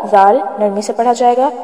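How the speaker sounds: ordinary delivery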